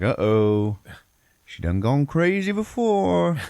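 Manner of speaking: high, drawn-out comic holler; mock alarm. Teller mocking the twist that she's been sick, comic alarm. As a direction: stretch it loud and high, hammed-up.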